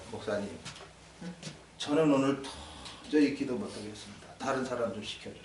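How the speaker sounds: meek plea. Retold or imitated. Quarreling member asks pastor.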